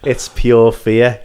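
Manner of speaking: ordinary talk, nothing marked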